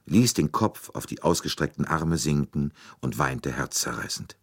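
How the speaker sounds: plain reading voice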